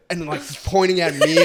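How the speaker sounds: ordinary talk, nothing marked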